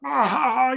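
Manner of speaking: high mocking ha ha ha; scornful laugh. Police chief laughing off the rabbi, scornful laugh. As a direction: laugh him off with contempt. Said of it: "He starts to laugh".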